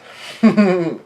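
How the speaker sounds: high, loud giggle; acted laugh. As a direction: giggle in character, loudly. Child urging Lillian to eat.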